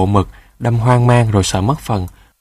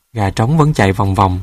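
ordinary delivery, nothing marked

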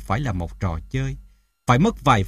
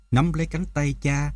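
plain reading voice

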